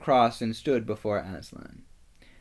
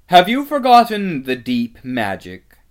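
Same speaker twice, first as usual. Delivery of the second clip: loud, high villainess challenge; piercing witch voice; challenging witch; 6 semitones higher, 13 dB louder than usual. A narrator's reading. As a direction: throw it down imperiously, starting high and falling.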